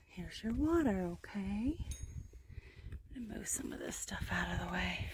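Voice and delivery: soft, quick whisper. Real, not acted. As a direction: whisper to keep it calm. Whispering while moving stuff.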